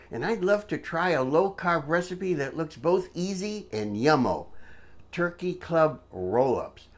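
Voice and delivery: ordinary talk, nothing marked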